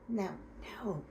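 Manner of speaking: ordinary delivery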